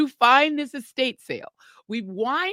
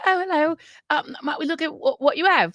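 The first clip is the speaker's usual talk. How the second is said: higher-pitched mock British greeting; put-on British accent; 5 semitones higher than usual. Disguised shopper greets the estate sale ladies, fake British accent. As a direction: politely feign a British accent. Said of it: "wheel out my patented British accent".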